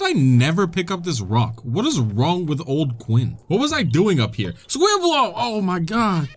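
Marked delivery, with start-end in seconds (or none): 4.7-6.3 1 excited greeting. Real, not acted. Greets a found Korok joyfully.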